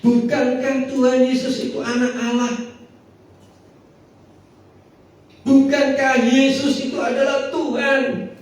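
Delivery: preacher's questions building to a peak; fiery preaching. Acted. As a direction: hammer the why again and again.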